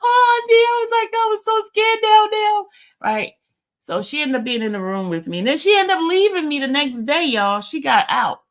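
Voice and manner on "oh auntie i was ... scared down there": high-pitched, tearful squealing; imitated happy crying. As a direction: wail it like an overjoyed, relieved young woman, imitated. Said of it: "She started crying".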